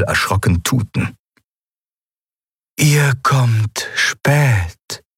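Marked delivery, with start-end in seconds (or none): 2.8-5.0 very slow, higher, strange melodic being; otherworldly voice. Acted. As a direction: speak slow and unearthly. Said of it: "Die Stimme war heller ... melodiös und unwirklich". (fremdartig, sehr langsam, melodiös)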